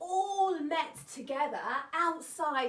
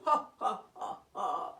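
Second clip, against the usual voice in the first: light chuckle after the joke; amused laugh; 7 semitones lower than usual. Real, not acted. Narrator amused by the elephant, chuckling. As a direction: laugh at the pushy animals.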